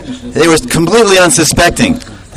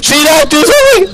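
very high frantic pleading chant; panicked praying; 10 semitones higher than usual. Retold or imitated. Krishna surrounded by gopis, very high mock-panicked praying. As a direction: gabble prayers in a hammed-up panic.

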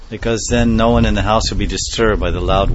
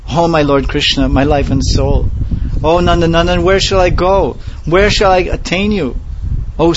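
anguished wailing plea; 8 semitones higher than usual. Acted. Mahaprabhu crying to his two friends for Krishna, anguished. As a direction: cry out for the lost beloved, heartbroken.

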